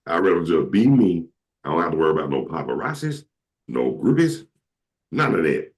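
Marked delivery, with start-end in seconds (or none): none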